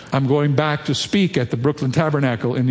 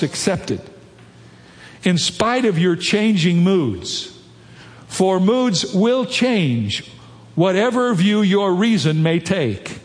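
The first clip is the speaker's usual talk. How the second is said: higher, slow, measured reading aloud; emphatic quotation reading; 3 semitones higher, slower than usual. Acted. Preacher quoting C S Lewis to the congregation, slow and emphatic. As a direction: read it out deliberately, lifting the key words.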